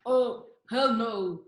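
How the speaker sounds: slow, effortful try at a low voice. Acted. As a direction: fumble toward a country star's low register. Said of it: "think more low".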